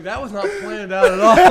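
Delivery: high, loud laughing line. Real, not acted. Host cracking up at his own joke, high and loud. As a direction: cackle it out in disbelief.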